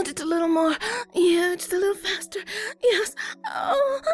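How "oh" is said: much higher-pitched moans; high, overwhelmed moaning; moaning with pleasure. Acted. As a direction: let the words break into moans. Acted intimate scene, moaning.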